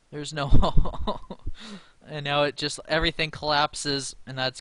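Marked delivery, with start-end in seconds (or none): 0.1-1.4 slow, louder, laughing through no; drawn-out laugh of defeat at the board; laughing despair. Real, not acted. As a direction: laughs mid-line, half crying. Losing to the computer.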